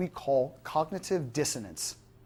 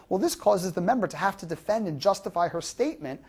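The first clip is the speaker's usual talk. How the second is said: normal talking voice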